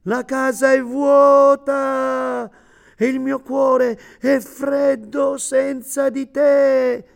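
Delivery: lamento acuto e lento, in lacrime; voce rotta dal pianto. A narrator's reading. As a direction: implora piangendo, molto più acuto e lento. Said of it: "una voce rotta dal pianto". (uomo in lacrime che implora la moglie perduta, voce rotta e acuta)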